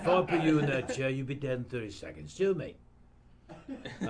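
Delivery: menacing Cockney threat; London hardman voice; Jason Statham impression. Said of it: "he says six words".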